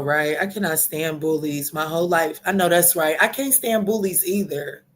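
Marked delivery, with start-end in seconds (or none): none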